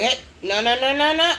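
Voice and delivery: quick rising string of reprimands; mock scolding. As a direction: scold the puppy, climbing in pitch. Acted scolding of the puppy.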